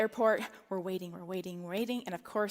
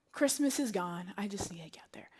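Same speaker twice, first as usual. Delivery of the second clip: defeated whisper; 4 semitones lower, 7 dB quieter than usual. Retold or imitated. Mother on the international call, defeated whisper. Despair on the phone.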